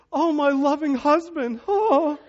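wife imagined greeting him, high swooning delight. Retold or imitated. Wife greeting husband in his imagined version, high mock swoon. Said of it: "this is where she goes".

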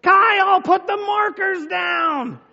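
high, loud, stretched-out mock yell; wife yelling. Mom yelling at unseen kid with markers, imitated shout. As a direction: yell it across the house like mom. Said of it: "she starts yelling".

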